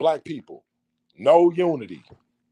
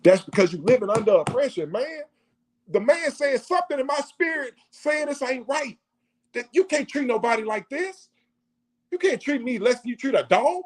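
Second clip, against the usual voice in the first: imitated argument; 8 semitones higher than usual.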